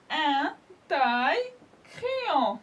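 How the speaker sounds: very slow, drawn-out syllables; slow model word. Shown as a demonstration. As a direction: stretch the word for children. (très lentement, en détachant les syllabes)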